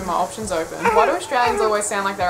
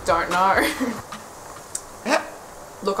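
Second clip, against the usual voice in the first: usual speaking voice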